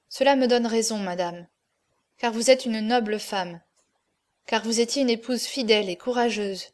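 usual speaking voice

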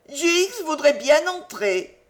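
put-on high, pleading small-child voice; piteous little child. A narrator's reading. Small child begs the company to let her in, piteous childish voice. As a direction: pipe up high like a begging toddler. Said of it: "sa petite voix enfantine".

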